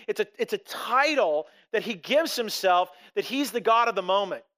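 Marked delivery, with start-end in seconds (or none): none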